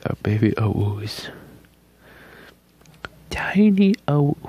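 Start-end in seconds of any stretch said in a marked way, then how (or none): 3.3-4.4 high cooing. Real, not acted. Cooing at baby owls.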